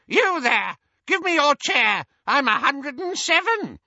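put-on high, loud, demanding old woman; piercing, bossy crone; imperious old aunt. A narrator's reading. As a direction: order them about in a shrill old voice. Muriel demands a seat.

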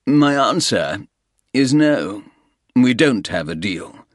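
firm refusal. Acted. Soames refusing the Russian's offer, loud and firm. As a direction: say no and mean it.

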